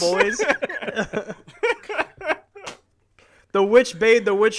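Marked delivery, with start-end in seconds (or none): 0.5-2.7 squealing laugh; high giggle. Real, not acted. Laughing at band name.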